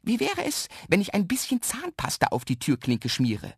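lightly voiced mischief-maker, high at the start; cheeky little man. A narrator's reading. Little man tempts boy with pranks, lightly cheeky. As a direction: float the idea with a high opening.

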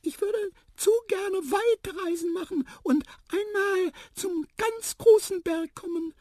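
very high, eager little voice; squeaky mouse. A narrator's reading. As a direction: confide a wish in a mouse squeak. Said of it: "piepste Tiputip". (piepsige Mäusestimme)

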